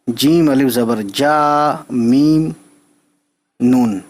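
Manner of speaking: ordinary talk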